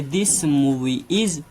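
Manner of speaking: very slow, word by word example sentence; slow dictation. Shown as a demonstration. Teacher dictating an example to students, very slow. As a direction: spell it out slowly for the class. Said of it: "तो उसके साथ हम more लगाएंगे".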